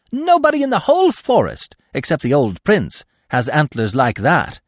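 high, loud squeaky praise; admiring squirrel. A narrator's reading. Squirrel flattering Bambi, high and loud. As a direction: gush over him.

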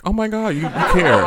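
high, hammed-up gushing; mock touched surprise. Host imagines walking into a surprise party, mock touched. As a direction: act overwhelmed by the gesture.

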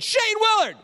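high, loud call of a challenger. Retold or imitated. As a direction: imitate a boy shouting out a name to pick a fight. Challenger calls out his name.

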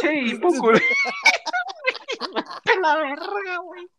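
high, breaking into laughter; laughing. Real, not acted. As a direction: curse through helpless laughter. Laughing at own hiccups.

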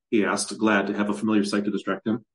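ordinary delivery, nothing marked

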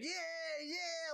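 mock the buddy who is way too into it; overexcited friend. Friend hyped about wrestling game.

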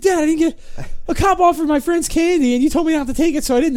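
high-pitched imitation of an aggrieved kid; mad at dad. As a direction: complain up high as a cross child. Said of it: "he got mad at his dad".

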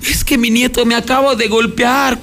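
mock-sob it out as the beaten grandmother; a touch of a weepy grandmother; crying old lady. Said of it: "la pobre señora llorando".

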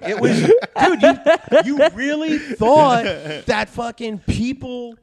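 high, loud, slow laughing rant; laughing disbelief. Real, not acted. Friend mocks fear of crack, high laughing disbelief.

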